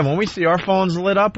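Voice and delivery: ordinary talk, nothing marked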